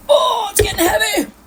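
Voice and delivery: high, loud laughing shout; strained laughing cry. Real, not acted. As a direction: yelp with strain as the dough gets heavy. Dough strains her stirring arm.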